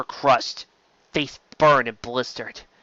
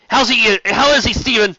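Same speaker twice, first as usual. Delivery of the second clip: higher, louder anxious cry; urgent call; 10 semitones higher, 8 dB louder than usual. Acted. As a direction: call out in alarm for the doctor. Captain finds wounded crewman.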